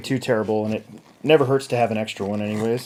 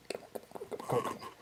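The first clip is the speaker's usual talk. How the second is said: whispered pleading; 2 semitones higher, 15 dB quieter, faster than usual. Real, not acted. Straining to loosen a tight tire.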